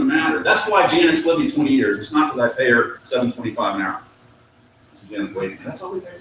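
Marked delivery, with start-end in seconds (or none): 5.6-6.2 stunned whisper. Retold or imitated. Office manager's stunned aside.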